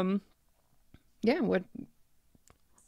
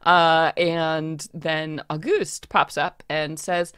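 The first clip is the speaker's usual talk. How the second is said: ordinary talk, nothing marked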